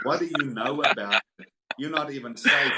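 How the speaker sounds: wife snapping at a drunk husband, retold angry. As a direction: replay the wife's outburst, indignant. Wife scolding him about ministry.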